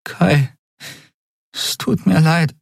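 high, halting tearful apology; heavy-tongued apology. A narrator's reading. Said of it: "brachte sie mit schwerer Zunge hervor". (mit schwerer Zunge, stockend)

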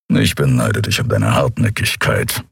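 tiefes Brummen des Bären Kero, deutlich tiefer; brummend. A narrator's reading. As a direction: brumm es tief und gutmütig. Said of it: "brummte Kero". (tief brummender Kero)

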